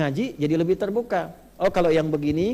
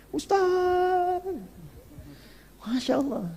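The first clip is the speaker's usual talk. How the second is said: joyful mother; much slower than usual. Retold or imitated. Mother returns with good news.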